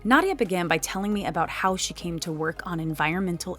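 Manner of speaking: lively, emphatic guest introduction; podcast presenter read. Acted. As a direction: introduce the expert like a polished radio host. Host's scripted guest introduction.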